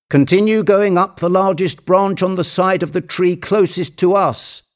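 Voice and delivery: high, urgent treasure hunter; commanding Legrand. Acted. Legrand directing his climber up the tree, high and insistent. Figure of the story giving orders.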